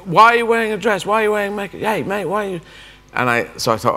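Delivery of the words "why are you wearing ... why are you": put-on high, teasing teenage girl taunt; mocking teenage girls. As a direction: needle him like a cheeky schoolgirl. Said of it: "they kept saying".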